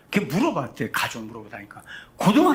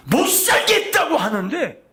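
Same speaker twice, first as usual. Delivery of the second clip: higher, louder and slow, 살겠다고 stretched; sarcastic slow emphasis; mocking emphasis; 10 semitones higher, 5 dB louder than usual. Real, not acted. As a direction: drag out 살겠다고 in mockery. Repeated mocking complaint.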